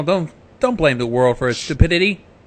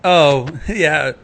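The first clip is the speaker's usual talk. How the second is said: usual speaking voice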